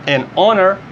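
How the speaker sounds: honor with a silent H, starting on the vowel; English pronunciation. Shown as a demonstration. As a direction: drop the H in honor. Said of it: "esse H é mudo". (honor sem pronunciar o H)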